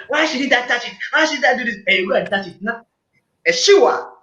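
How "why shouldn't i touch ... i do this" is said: put-on higher, defiant whine of a child who won't listen; defiant inner voice. Partner questioning the warning, higher and defiant. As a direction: push back like a stubborn kid. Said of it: "But in your mind".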